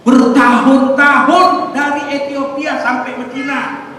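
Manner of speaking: slow, loud, drawn-out proclamation; loud preaching. Real, not acted. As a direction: hammer it home to the congregation. Preaching at full force. (khotbah lantang dan lambat)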